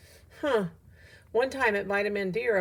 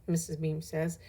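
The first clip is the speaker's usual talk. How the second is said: ordinary delivery, nothing marked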